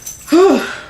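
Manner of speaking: high breathy release of emotion; shaky high sigh after crying; emotional sigh. Real, not acted. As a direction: let the tension out in one sigh. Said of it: "I apologize for that".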